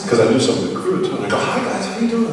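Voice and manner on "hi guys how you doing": cheerful greeting. Retold or imitated. Cheerful greeting to serious cast.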